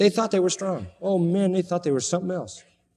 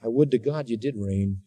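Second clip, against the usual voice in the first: low, softer mock wish; dry sarcasm; 3 semitones lower, 4 dB quieter than usual. Preacher as Paul to would-be kings, dry sarcasm. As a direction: wish it on them drily, pitched low. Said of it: "It's all just sarcasm".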